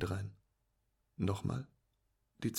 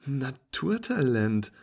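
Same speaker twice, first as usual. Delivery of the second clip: congratulating; 4 semitones higher, 8 dB louder, slower than usual. Acted. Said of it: "gratuliert sie".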